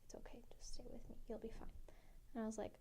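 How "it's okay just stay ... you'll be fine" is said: soft, quick, voiceless whisper; whispered reassurance. Retold or imitated. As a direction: hush her gently, barely a breath. Dad calmly whispering to his daughter.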